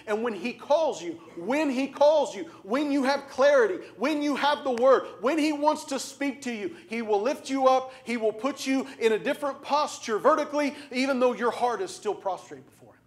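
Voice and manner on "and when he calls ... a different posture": rising preacher's cry, louder and higher; preaching. Acted. Preacher rousing the congregation, louder and higher. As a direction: build the call like a revival preacher. Preaching builds to full force.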